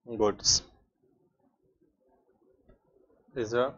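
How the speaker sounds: slow, word by word, leaving pauses to write; slow dictation. Acted. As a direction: dictate it so the class can copy. Teacher dictating definition.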